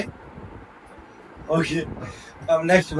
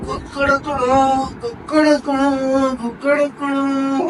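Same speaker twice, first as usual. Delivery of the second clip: higher, slow stretched crowing; rooster crow; 8 semitones higher, much slower than usual. Pretend. Made to crow again, slow and higher. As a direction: draw each crow out like a rooster. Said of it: "फिर दोबारा".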